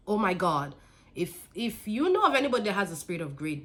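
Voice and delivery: usual speaking voice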